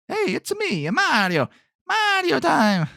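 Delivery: very high cartoon Mario voice, slow on Mario time; Mario impression. Podcaster imitates Mario's famous voice, very high cartoon. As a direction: pitch way up, bouncy Italian plumber.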